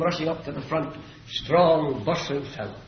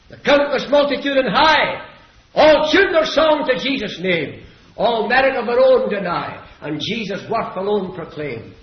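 raised, declaimed hymn verse; preach; 7 semitones higher, 8 dB louder than usual. Real, not acted. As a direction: recite the hymn with pulpit fervour. Recites hymn at pulpit force.

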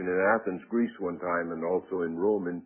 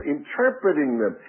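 ordinary talk, nothing marked

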